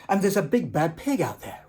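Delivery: ordinary delivery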